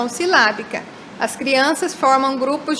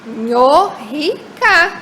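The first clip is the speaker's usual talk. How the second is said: syllables drawn out; much slower than usual. Shown as a demonstration. Teacher showing the reversed word to the class, drawn out very slowly. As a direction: say Nhorrica very slowly, syllable by syllable, with a slight lift in level. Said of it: "se elas querem falar que ele brinca de carrinho".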